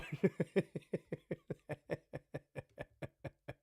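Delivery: real breathy giggling fit; soft, drawn-out wheeze of laughter; long laugh. Real, not acted. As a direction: laugh on and on, softly. Laughs at a viewer's comment.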